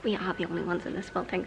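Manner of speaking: low, soft, quick murmur with voice; hushed voice. Real, not acted. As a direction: drop the whisper but stay very quiet. Repeats whispered line in low voice.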